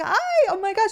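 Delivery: very high, squealy greeting; gushing hi. Retold or imitated. Creator greeting a fan on the street, squealing high. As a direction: squeal hello like meeting a friend.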